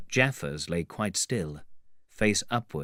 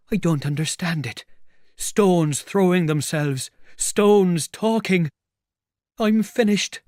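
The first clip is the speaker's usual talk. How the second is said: bewildered despair; 9 semitones higher, 6 dB louder than usual. Acted. Tramp pelted by stones that talk, despairing.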